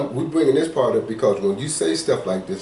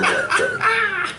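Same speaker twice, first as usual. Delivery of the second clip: mock outraged squeak. Mocking caller's outrage.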